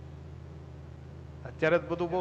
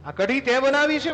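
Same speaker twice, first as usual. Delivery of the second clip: scolding; 6 semitones higher, 5 dB louder than usual. Retold or imitated. Angry mother-in-law scolds.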